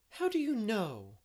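high, loud, drawn-out astonishment; amazed gasp. A narrator's reading. Jane caught out by her friend's guess, amazed. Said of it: "gasped Jane, amazed".

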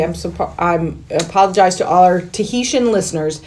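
ordinary talk

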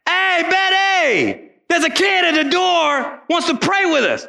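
high, slow call back into the house; a man hollering for his wife, amazed. Retold or imitated. Man calls to wife indoors.

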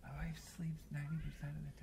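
hushed confiding whisper. Real, not acted. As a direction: whisper it like a secret. Embarrassed confession on the show.

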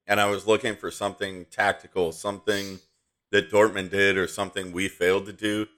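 ordinary talk

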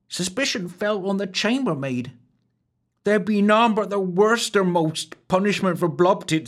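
mild mournful lilt in a high rustic maid's voice; the wench grieving the innocent chambermaid; a touch of sorrow, high and rustic. A narrator's reading. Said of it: "before continuing mournfully".

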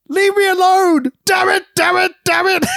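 high mock yell, hammed-up; mock Sid shout. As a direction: yell it like a hurt wrestler overselling. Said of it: "As he's selling".

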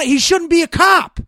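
erupting in outrage at an injustice; angry outburst. Real, not acted. Ranting to a co-host about the Minnesota shooting, furious and loud. Heated rant peak.

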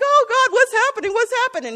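high, loud panicked plea; panicked cry. Pretend. Believers cry out to God as things fall apart, panicked. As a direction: sound like everything is collapsing. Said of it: "we're like".

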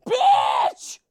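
sudden high, loud yelled "Bitch!"; screamed insult. Retold or imitated. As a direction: blurt it as loud as you can. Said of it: "he just screams".

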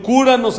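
cúranos lifted high and loud; cúranos called out high as a plea. Shown as a demonstration. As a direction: lift cúranos up like a prayer. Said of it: "Si yo digo".

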